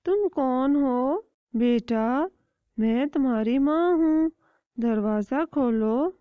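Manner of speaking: slow, coaxing fake-mother voice; a hint of a scheming bird; sly kite. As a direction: trick the chick into opening. Kite pretends at door.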